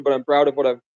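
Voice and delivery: ordinary delivery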